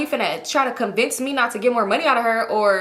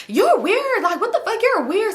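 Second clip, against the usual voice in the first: high-pitched, offended retort, imitated; squeaky put-on outrage; mock indignation; 10 semitones higher than usual. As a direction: act out a jealous friend snapping at him. Acting her indignant reply to him.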